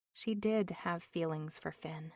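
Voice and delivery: ordinary delivery, nothing marked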